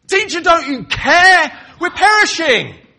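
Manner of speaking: frantic accusing shout; desperate shout. Shown as a demonstration. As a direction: yell it over crashing waves. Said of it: "Something more like that to be heard above the crash of the waves".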